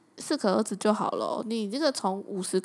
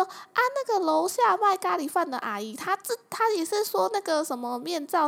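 higher mom voice, chatty and eager; 8 semitones higher than usual. Retold or imitated. Mom telling daughter the curry auntie wants one too. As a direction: pass on the gossip like an excited mother. Mom reporting neighbor's interest.

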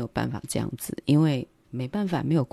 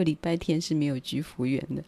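ordinary delivery

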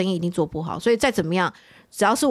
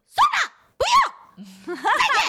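much higher, slow and drawn out, calming down; still high-pitched; much slower than usual. Retold or imitated. Customer hanging up on the manager, high slow resigned mock cry. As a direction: stretch it out high as she gives up. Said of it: "讲话还是高八度".

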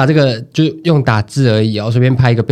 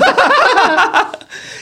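high laugh. Real, not acted. Laughs at shared irony.

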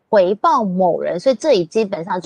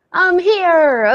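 acting a text message; 9 semitones higher, 4 dB louder than usual.

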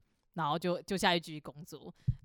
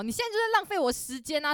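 mild mock scolding; 7 semitones higher than usual. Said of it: "那阿嬷继续骂".

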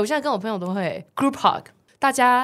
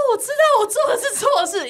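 much higher, whiny, half-laughing apology; mock crying confession; 13 semitones higher than usual. Recalling being scolded, a high mock-tearful apology. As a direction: whimper it like a scolded kid, laughing. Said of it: "我就开始大哭".

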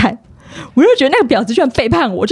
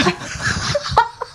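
laugh; 9 semitones higher, 8 dB quieter than usual. Real, not acted. Laughs at armpit comment.

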